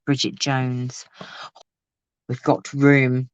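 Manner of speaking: ordinary talk, nothing marked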